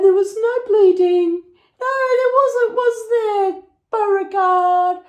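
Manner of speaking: sustained high, strained squeal; comic high-pitched exasperation; mock hysterical. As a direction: wail it in exaggerated disbelief at the dog. Mock outrage at dog over bill.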